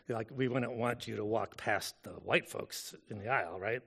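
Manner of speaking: dry sarcastic aside; deadpan mock reasonableness. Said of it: "I'm being sarcastic".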